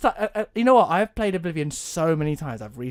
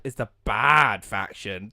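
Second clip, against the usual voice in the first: "bad" heavily stretched out; stress on bad; 3 semitones lower, slower than usual. Real, not acted. Teller explains the Horde to a non-player, stress on "bad". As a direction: draw out the word "bad" for emphasis.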